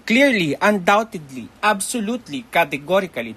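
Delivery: confident teacher's showy emphasis; emphatic certainty. Shown as a demonstration. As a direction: hammer home every booster. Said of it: "those are boosters".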